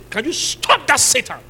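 acted command. Preacher challenging the young to cast out Satan, loud rebuke. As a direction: lean hard and loud into the order.

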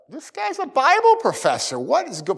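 high, puzzled disbelief; incredulous thought. Retold or imitated. Preacher recalls shock at a cold Bible professor, high. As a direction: sound baffled at the professor.

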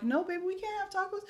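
soft, high-pitched mom voice; imitating mother. Retelling mom refusing her crying boy tacos, soft imitated mother. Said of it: "And then she's like".

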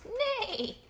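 very high horse neigh; high-pitched play neigh. Acted. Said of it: "I think he says neigh".

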